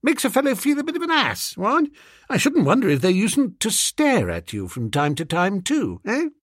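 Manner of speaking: high, quick, fretting upper-class master; embarrassed gentleman. Acted. Master confiding to his valet his unease with schoolgirls, embarrassed. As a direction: hurry it out high, seeking agreement.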